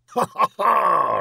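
loud, high stage "ha, ha, ha"; roaring laugh. A narrator's reading. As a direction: roar with a tyrant's hearty laughter. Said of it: "roared the latter".

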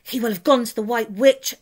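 lightly voiced stern beaver; grim beaver. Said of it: "said Beaver grimly".

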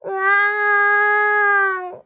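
high, sustained mock wail; baby crying. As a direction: bawl like a baby. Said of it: "빠오베이의 울음소리에". (아기처럼 으앙 우는 소리)

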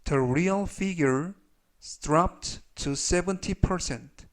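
very slow, measured English read-aloud; slow model reading. Shown as a demonstration. Teacher reads example sentences for learners to review, slowly. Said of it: "천천히 세 번씩 읽어드리고요".

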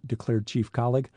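plain reading voice

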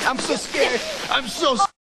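high-pitched teasing fake fright; mock fear. Taunting a friend in a snow fight, mock-scared.

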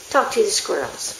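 ordinary talk, nothing marked

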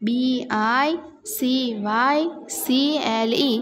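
very slow, letter-by-letter spelling; slow classroom spelling with gaps. Shown as a demonstration. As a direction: draw out each letter for the class. Teacher spells word for class.

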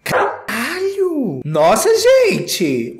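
high, slow drawn-out shock; stunned exclamation. Real, not acted. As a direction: gasp it out amazed. Stunned by model's walk.

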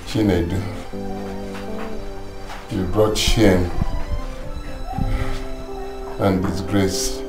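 a dying father speaks his last words, low and faint; dying man's weak voice. Acted. Dying father to his wife, very low and faint.

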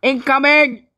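loud, high callout; shouted warning. Real, not acted. Player warning the team of attack, shouting. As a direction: call it out to the team.

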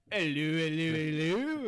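hammed-up policeman's hello with odd vowels; mock policeman. Actor switching on at "action", hammed-up policeman voice. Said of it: "Sounds like a policeman".

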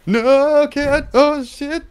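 very high, stretched cry; panicked squeal. Real, not acted. Player in a tense game moment, high panicked squeal. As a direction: squeal in high-pitched dismay.

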